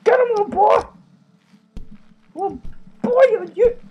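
high cheering yell; excited high shout. Real, not acted. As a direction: cheer him on. Cheering on game character.

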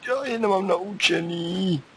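high, slow whiny voice, drawn out. Pretend. Gamer whining high and slow that he lacks skills. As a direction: whine like a sulky kid.

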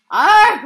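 loud, high cry of pain; injured second baseman moaning. A narrator's reading. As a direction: groan out in pain. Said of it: "moaned the second baseman".

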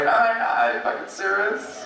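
put-on high, shrieky drunk girl squeal; mock a wasted girl leaving the bar; hammed-up tipsy girl outrage.

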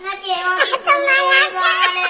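very loud, drawn-out bawling; crying tantrum. Acted. Child refusing a puppy, wailing for a tiger. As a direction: howl the refusal, stretching the words. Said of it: "ఊకే ఏడ్చి".

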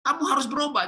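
very high, pushy parental demand; demanding reproach. Shown as a demonstration. Parent ordering a child to change, demanding reproach. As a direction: insist in a high, scolding voice.

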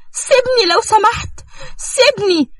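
very high, frantic pleading; desperate plea. Acted. Nour begs Selim to let go of her. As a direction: beg to be let go.

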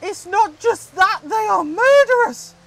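high, rising to a shout; alarmed protest. Real, not acted. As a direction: protest in shrieked outrage. Protesting about buses in game.

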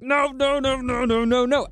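high, rapid-fire mock-panicked protest; hammed-up pleading refusal. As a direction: dramatize dread in a high, insistent run. Acts out dread at sequel news.

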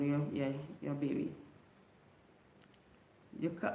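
ordinary talk, nothing marked

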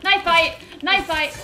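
loud, high, eager battle cry. Real, not acted. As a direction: shout it going into combat. Attacking an enemy.

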